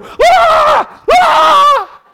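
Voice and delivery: loud, high comic shriek; hammed-up scream of terror; mock panicked scream. Preacher showing what Jesus never did, mock scream. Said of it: "nowhere did Jesus go".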